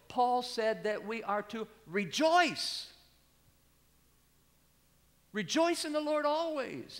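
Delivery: fiery preacher's climax; full-force preaching. Acted. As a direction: ring out "rejoice" from the pulpit.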